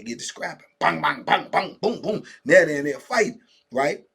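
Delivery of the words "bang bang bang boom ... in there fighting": ordinary talk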